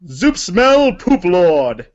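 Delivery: ordinary delivery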